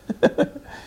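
short breathy chuckle; a brief laugh to yourself. Real, not acted. Speaker losing his thread before the group, chuckling.

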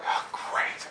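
whispery, spent gasp; breathless relief. Retold or imitated. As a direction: gasp it softly, clutching your heart. Clutches heart after the fright.

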